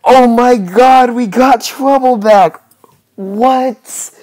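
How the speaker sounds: high, loud and slow "Oh my God"; stunned real joy; thrilled shock. Real, not acted. Shocked Trouble returned.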